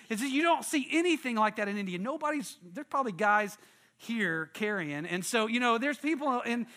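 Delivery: ordinary delivery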